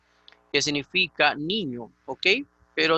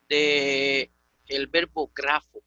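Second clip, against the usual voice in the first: ordinary delivery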